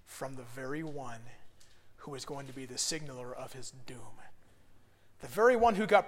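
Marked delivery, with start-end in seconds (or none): 0.1-4.2 ominous whisper. Real, not acted. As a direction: breathe it like a dark secret.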